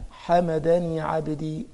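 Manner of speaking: slow reverent reading; slow reverent quote. Shown as a demonstration. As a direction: give the reply slowly, with reverence. Reciting the divine reply slowly. (قراءة بطيئة خاشعة)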